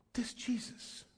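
hushed, breathless whisper; awed whisper. Retold or imitated. Friend marveling at Jesus, whispered. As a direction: whisper it in wonder. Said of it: "he goes this".

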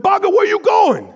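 put-on high, loud pleading cry of a boy; child's desperate yell. Boy calling after his leaving grandpa, loud and desperate. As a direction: yell after him like a frantic kid.

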